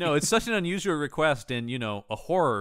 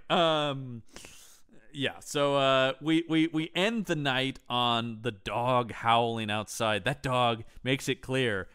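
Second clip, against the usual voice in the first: ordinary delivery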